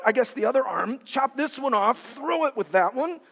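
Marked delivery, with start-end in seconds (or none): none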